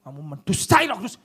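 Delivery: loud accusing shout; God through Peter, shouted rebuke of a liar; angry rebuke. Acted. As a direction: thunder the accusation. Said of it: "Begitu Tuhan ngomong sama Petrus".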